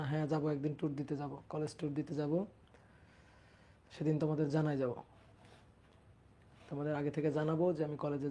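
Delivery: low, soft, close to the mic; speaking softly. Real, not acted. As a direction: keep the voice down, barely above a murmur. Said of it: "আমি আস্তে আস্তে কথা বলছি তো".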